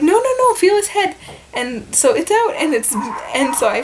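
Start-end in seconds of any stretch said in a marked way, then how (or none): 0.0-1.2 high, eager no no no; urgent insistence. Retold or imitated. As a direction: urge her to feel it. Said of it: "they're like".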